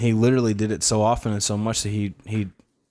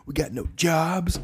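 mock whiny movie character; 6 semitones higher, slower than usual. Quoting Dumb and Dumber.

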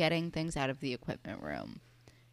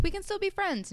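much higher, chirpy retold offer; mock-cheerful, pitched way up; imitated cheerfulness. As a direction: say it in a put-on cheery high voice. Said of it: "she's pretty cheerful about it".

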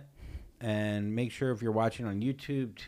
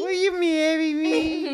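high, slow, whiny mock proposal; mocking whine; 5 dB louder, slower than usual.

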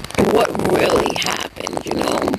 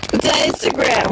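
normal talking voice

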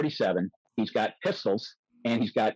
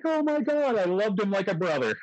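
high-pitched, swooning gush; gushing admiration; 10 semitones higher than usual. Retold or imitated. Interviewee retelling his joy at being defended, gushing. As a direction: gush in a far higher voice. Retold gushing reaction to the doctor.

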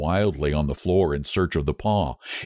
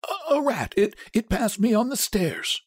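put-on high, stammering frightened old man; shaking tones. A narrator's reading. Old man lying to his wife about the knock, shaking tones. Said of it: "said the old man in shaking tones".

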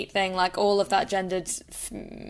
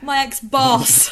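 embarrassed correction; 5 semitones higher, 7 dB louder, slower than usual. Real, not acted. Correcting a slip.

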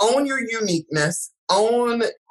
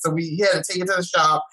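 ordinary delivery

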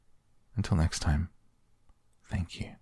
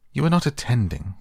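sternly scolding, raised; severe; 7 semitones higher, 6 dB louder than usual. A narrator's reading. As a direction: scold her sharply. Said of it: "said the mouse to Alice severely".

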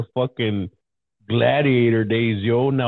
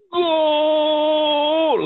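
long, much higher drawn-out gooool yell; imitating goal scream; 3 dB louder than usual. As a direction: hold the goal call like a Spanish announcer. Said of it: "this dude screams".

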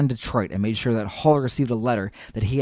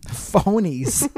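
mock denunciation; 6 semitones higher than usual.

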